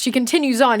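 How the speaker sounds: ordinary talk, nothing marked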